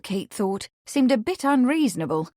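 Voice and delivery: usual speaking voice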